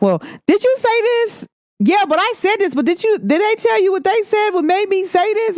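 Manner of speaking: high-pitched back and forth quarrel imitation; mock group argument. Retelling a group dispute over who said what. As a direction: pitch the voices way up, arguing. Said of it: "the person be like".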